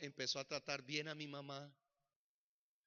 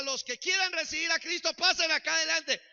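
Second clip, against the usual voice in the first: pastor calling out loud and high; mock altar call; 12 semitones higher, 11 dB louder than usual. Imitating the pastor's altar call to the church. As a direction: belt out the altar call like a preacher.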